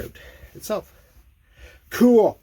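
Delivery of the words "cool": sarcastic. Real, not acted. Seized bolt, mock surprise.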